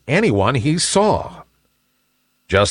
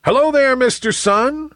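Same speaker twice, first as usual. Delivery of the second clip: high, lilting group greeting; high-pitched singsong hello; clouds in chorus. A narrator's reading. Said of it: "said the clouds in chorus".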